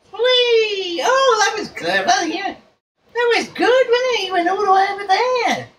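delighted high pet talk. Real, not acted. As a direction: coo up high like talking to a clever pet. Praising the pet bird.

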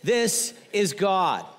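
loud, very slow command, word by word; emphatic, drawn-out mock-divine rebuke; God silencing Peter. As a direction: cut someone off with heavenly authority. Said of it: "In other words".

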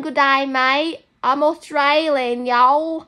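loud, drawn-out mock Australian accent; badly mixed-up Australian accent; attempted Australian accent. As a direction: play a hammed-up Aussie, slow and loud. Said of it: "one time I did Australian".

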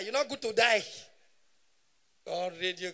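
ordinary talk

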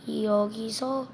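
ordinary talk

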